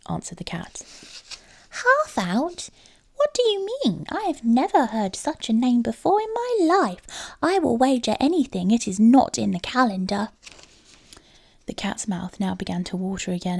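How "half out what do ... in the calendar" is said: high, wondering mouse voice, louder; a mouse who has never heard such a name; puzzled little mouse. A narrator's reading.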